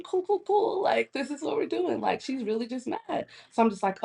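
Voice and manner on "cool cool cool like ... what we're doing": high-pitched mock-agreeable reply; condescending. Woman reacting to annoyed friend, retold in high condescending voice. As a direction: answer with put-on condescending cool.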